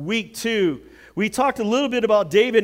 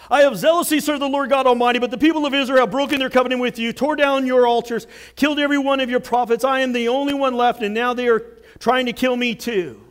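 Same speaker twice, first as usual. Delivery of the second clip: higher, louder, rushed whining complaint; whiny rehearsed narrative; 4 semitones higher, 6 dB louder, faster than usual. Retold or imitated. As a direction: rattle off the well-worn excuse. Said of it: "Ready for the narrative?".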